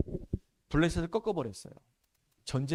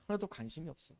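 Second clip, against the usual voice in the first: quiet disdain; 11 dB quieter than usual. Acted. Preacher lamenting the disciples' indifference, dropped to a soft aside. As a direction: let it fall away quietly. Disciples care nothing for the word.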